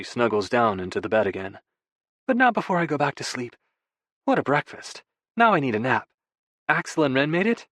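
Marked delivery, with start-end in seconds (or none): 2.3-7.7 higher, lighter voice for a contented wife; lightly lilting woman's character voice; playful wife. A narrator's reading. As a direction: play her contented and teasing, pitched up. Narrator voices Freya.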